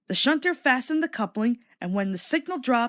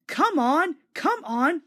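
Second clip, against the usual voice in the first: eager higher chugging call; puffing; 7 semitones higher, slower than usual. A narrator's reading. Thomas urging the trucks along, puffing eagerly. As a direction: puff it out eagerly. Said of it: "he puffed".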